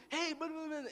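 high gibberish mimicking Bahamian speech; imitated Bahamian dialect. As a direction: babble like a mock Bahamian local. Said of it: "the Bahamian dialect, the, the accent".

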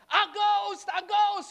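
high, loud cry of alarm; terrified crying out. Acted. Disciples crying out in the storm, terrified shout. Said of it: "they cried out".

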